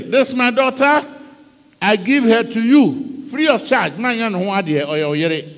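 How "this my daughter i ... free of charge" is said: louder, higher king voice; voiced king offering. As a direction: proclaim it like a generous king. King gives daughter to Joseph.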